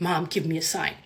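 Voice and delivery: low, soft inner voice; voicing a thought. Silently asking mom for a sign in bed, softly voiced thought. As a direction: say it softly, as if only thinking it. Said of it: "in my mind, I said that".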